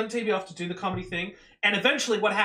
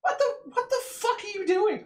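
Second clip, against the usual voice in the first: incredulous open micer; 13 semitones higher than usual. Retold or imitated. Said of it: "being like".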